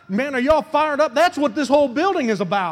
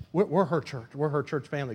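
ordinary talk

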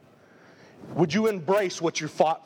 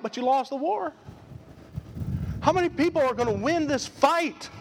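ordinary talk, nothing marked

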